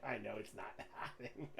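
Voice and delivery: unhinged cackling laughter bursting into high peals; delirious laugh that swallows the line; feral giggling. Acted. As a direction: giggle madly through the words. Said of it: "he just kind of starts giggling".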